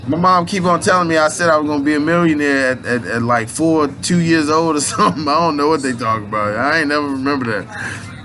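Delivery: ordinary talk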